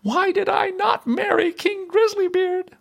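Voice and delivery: very high, rueful princess; sighing regret. A narrator's reading. Princess wishing for another husband, sighing. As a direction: question your choice in a high-pitched lament. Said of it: "sighed she".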